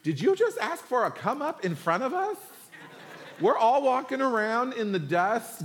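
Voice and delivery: high-pitched, hammed-up outrage; indignant. The ten to the two brothers, indignant. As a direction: protest in mock disbelief, pitched well up. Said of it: "they were indignant with the two brothers".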